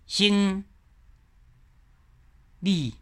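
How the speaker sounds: 生 and 理 each said apart in citation tone; separate syllables. Shown as a demonstration. Teacher splitting word into base tones. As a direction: pause between 生 and 理 on base tones. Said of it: "哦，生意".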